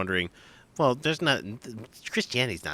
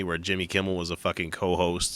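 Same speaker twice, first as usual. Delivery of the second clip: ordinary delivery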